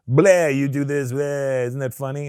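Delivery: mocking a lazy bad accent impression; a mock clueless impression; mock bumbling accent. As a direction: dumb fake-accent voice, half-hearted. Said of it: "doing just this blind fucking".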